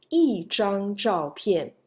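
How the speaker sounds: slow 一张照片, 一 falling sharply; fourth-tone 一. Shown as a demonstration. Teacher demonstrates the rule, 一 in falling fourth tone. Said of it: "you may use a fourth tone for E".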